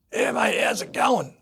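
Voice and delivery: high, slow, loud mock-cheery mate voice; booming bloke's hello, hammed up; Smithy on the phone. Retelling the call to Smithy, loud cheery imitated greeting.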